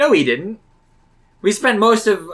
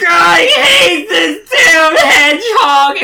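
slow, sobbing scream; hammed-up weeping yell; anguished shout; 13 semitones higher, 9 dB louder, much slower than usual.

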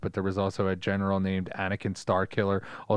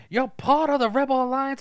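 high-pitched hammy British villain; exaggerated British accusation; overboard British actor; 4 dB louder than usual. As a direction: declaim it like a theatrical British actor. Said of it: "He was, like, a British actor".

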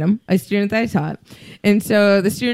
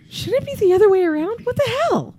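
retold baffled outburst, much higher; mock disbelief; 13 semitones higher than usual. Instructor retelling her shock at being comforted by a student, high mock disbelief. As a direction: squeak out your own confused reaction. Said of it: "I'm going".

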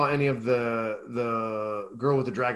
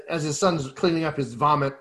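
usual speaking voice

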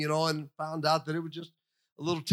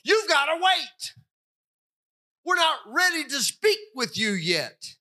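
shouted order; 7 semitones higher than usual. Retold or imitated. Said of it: "I've been told".

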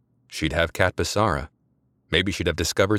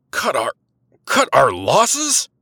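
much higher, louder slow disbelieving shriek; near shriek; 8 semitones higher, 6 dB louder, slower than usual. A narrator's reading. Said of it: "Rennick practically shrieked".

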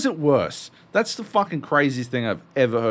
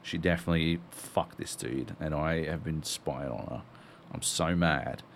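low muttering Bond; 7 semitones lower, 8 dB quieter than usual. Retold or imitated.

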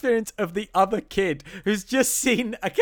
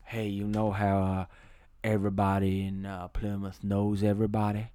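slow, low, hushed movie-star drawl; McConaughey-style low murmur; low conspiratorial drawl; 10 semitones lower, 7 dB quieter than usual. Retold or imitated. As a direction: lean in and drop the voice, slow. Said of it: "at some point he goes".

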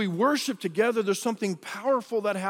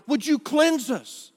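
much higher, louder desperate cry; pleading lepers; 9 semitones higher, 5 dB louder than usual. Retold or imitated. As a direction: beg Jesus to heal you. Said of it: "they were like".

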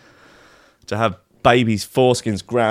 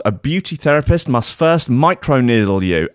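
ordinary delivery

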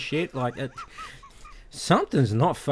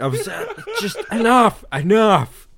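high, laughing cry of enough; cracking up while pleading enough; laughing through a quote; 10 semitones higher, 6 dB louder, slower than usual. Retold or imitated. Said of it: "Fine, just do what you want".

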